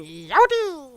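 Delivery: loud twangy fake sneeze; southern sneeze. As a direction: sneeze it like a cowboy. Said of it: "El Papi going".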